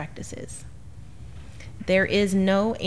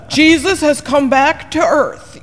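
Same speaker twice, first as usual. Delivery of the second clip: high, loud, slow tabloid hype; mock sensational headline; 8 semitones higher, 8 dB louder, slower than usual. Teaching the kids, reading a tabloid headline in a high, loud mocking voice. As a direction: read it like a breathless tabloid.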